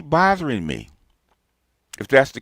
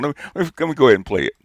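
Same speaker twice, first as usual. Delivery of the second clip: ordinary talk, nothing marked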